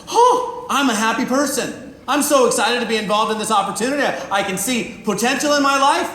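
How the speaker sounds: hammed-up happy enthusiasm; mock excited. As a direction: gush like a thrilled new recruit. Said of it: "you get all excited about it".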